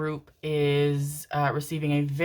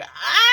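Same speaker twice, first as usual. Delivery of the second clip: loud high mock wail breaking into sobs; hammed-up open-mouthed bawling; imitated sobbing wail; 8 dB louder than usual. Viewer reenacting her reaction to the finale, loud wailing sobs. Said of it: "wailing, sobbing. I was like".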